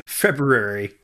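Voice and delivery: February as FEB-roo-airy, every R sounded; full pronunciation. Shown as a demonstration. Guest takes his turn saying the month, February as FEB-roo-airy. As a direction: sound all four syllables of February. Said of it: "say the month".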